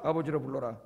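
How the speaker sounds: hushed repeat of the command; quiet echo. Acted. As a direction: let it fall softly.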